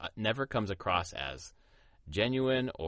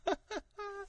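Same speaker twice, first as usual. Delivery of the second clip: high squeal laugh; 6 dB quieter than usual. Real, not acted. Laughs at the comment.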